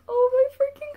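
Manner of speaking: loud, high-pitched panic; flustered outburst. Retold or imitated. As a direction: burst out in giddy alarm. Panics as he walks over.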